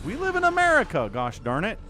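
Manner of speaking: we live in America, thrown up high and loud; mock patriotic outburst. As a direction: belt it like a cartoon patriot.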